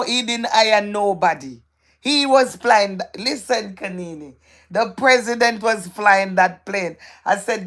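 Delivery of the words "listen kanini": ordinary talk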